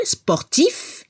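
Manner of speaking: sportif with a crisp final f; sportif, end cleanly on f; final F heard. Shown as a demonstration. Teacher repeating the masculine for the class. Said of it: "Al masculino se escucha la F al final".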